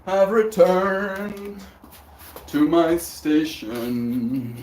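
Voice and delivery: slow, drawn-out dramatic entrance; grand announcement. Pretend. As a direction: proclaim it like a returning hero. Dramatic return greeting on stream.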